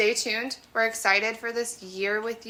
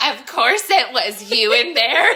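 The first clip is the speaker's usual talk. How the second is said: mocking the rival; 3 semitones higher, 8 dB louder than usual. Rival from office party scolds.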